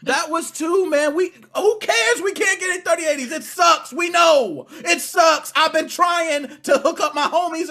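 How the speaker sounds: fake-sob a complaint about graphics cards; mock crying. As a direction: pretend crying that rises into a mock shout. Mock despair over sold-out cards.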